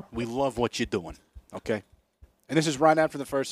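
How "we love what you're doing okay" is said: mob caller. Retold or imitated. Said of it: "he heard a voice that goes".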